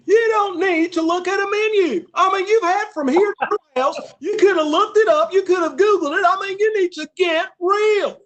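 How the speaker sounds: high, loud exasperated rant; hammed-up impression of an agitated waiter blowing up; Doctor Phil, agitated. TV-doctor waiter scolds diner for wanting a menu, mock furious. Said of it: "he'd be all upset".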